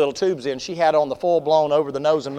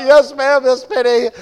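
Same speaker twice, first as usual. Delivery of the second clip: flustered yes ma'am; 9 semitones higher, 11 dB louder, slower than usual. Retold or imitated. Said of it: "I'm like".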